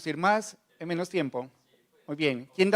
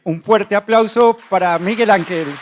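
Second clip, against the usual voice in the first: loud call-out; 4 semitones higher, 10 dB louder than usual. Real, not acted. Host calls for applause.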